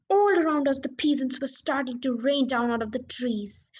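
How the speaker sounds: ordinary delivery, nothing marked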